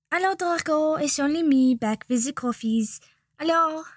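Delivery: put-on high, sing-song French accent greeting; a cheery French shop assistant chiming in; Nicolas, French chime. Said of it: "Nicolas chimed in".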